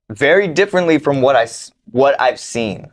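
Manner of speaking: usual speaking voice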